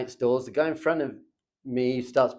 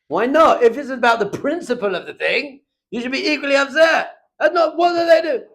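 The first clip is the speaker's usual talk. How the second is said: louder and higher, exasperated challenge; indignant outburst; 10 semitones higher, 9 dB louder than usual. Real, not acted. Rabbi challenges student upset over sushi, loud and indignant. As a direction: throw it back at him, incredulous.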